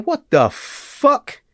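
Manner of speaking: high, drawn-out retold disbelief; shocked disbelief. Telling how he reacted to the attack, shocked disbelief. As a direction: stretch it out high, re-enacting your shock.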